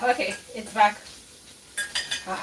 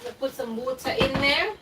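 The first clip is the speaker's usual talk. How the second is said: ordinary delivery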